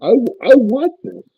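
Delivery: rising, thrilled and wanting; newly sober man gushing to his mentor; eager joy. Retold or imitated. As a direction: burst out with eager delight. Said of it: "I said".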